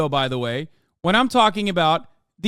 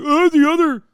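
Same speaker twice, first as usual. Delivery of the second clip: mocking chatters; 8 semitones higher, 7 dB louder than usual. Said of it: "thing to be like".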